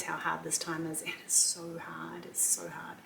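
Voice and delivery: emotional, trailing off into a whisper; a real catch of emotion, whispered; fading to a whisper. Real, not acted. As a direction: let it drop to a breathless whisper. Emotional about empty nest.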